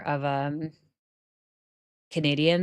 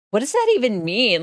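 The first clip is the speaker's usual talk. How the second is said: a light mock of a confused schoolkid, higher and louder; baffled kid; 6 semitones higher, 7 dB louder than usual. Junior high kid baffled by a British insult, mild mock outrage. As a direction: toss off the kid's bewildered question.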